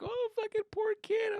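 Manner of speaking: high, far-off pitying voice; high mock sympathy; mocking onlookers. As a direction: pity him with a sneer.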